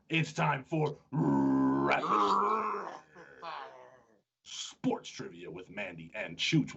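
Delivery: slow, drawn-out creepy announcer. Pretend. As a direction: creep out the listeners slowly. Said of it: "we got the creepy voice coming at ya".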